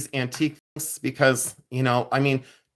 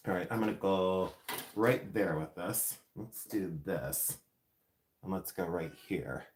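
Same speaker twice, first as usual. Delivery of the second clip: much softer, low and slow, concentrating; focused murmur; 7 semitones lower, 12 dB quieter than usual. Real, not acted. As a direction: murmur to yourself while working carefully. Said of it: "I have to focus".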